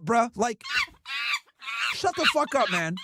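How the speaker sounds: ordinary talk, nothing marked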